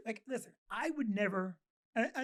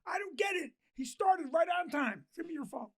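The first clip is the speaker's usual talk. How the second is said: higher-pitched mock exasperation; frustrated husband; 6 semitones higher than usual. Host voices the boss complaining to his wife, frustrated. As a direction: play a fed-up husband grousing to his wife. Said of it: "in a very frustrated moment and go".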